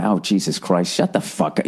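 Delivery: ordinary talk, nothing marked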